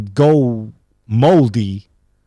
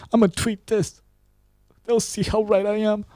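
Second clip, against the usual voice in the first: much higher, small mocking voice; mock indignant tweeter; 9 semitones higher, 5 dB quieter than usual. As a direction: mimic a self-righteous online complainer, high and soft. Mimics a self-righteous tweeter.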